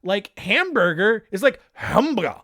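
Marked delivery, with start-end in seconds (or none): 1.7-2.4 Japanese accent. Shown as a demonstration. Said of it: "like, a Japanese accent".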